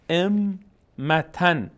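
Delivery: ائمتن very slowly, syllable by syllable, ending -tan; full tanwin ending. Shown as a demonstration. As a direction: sound the t and n closing ائمتن. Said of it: "حتی تنوین ان".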